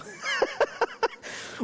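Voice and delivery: a burst of high laughter. Real, not acted. Preacher laughing at his own joke to the congregation.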